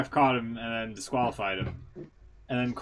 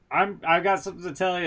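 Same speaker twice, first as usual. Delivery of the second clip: higher, quick character voice; mildly put-on higher voice; lightly voiced Lana; 6 semitones higher, 4 dB louder, faster than usual. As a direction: imitate a woman breaking news, raised pitch and hurried. Voicing Lana in a retold scene.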